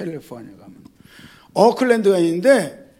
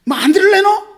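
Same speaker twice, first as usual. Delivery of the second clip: mock scolding; 10 semitones higher, 6 dB louder than usual. GPS voice scolding the driver.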